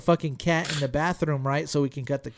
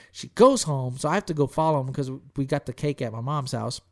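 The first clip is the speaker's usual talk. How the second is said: ordinary talk, nothing marked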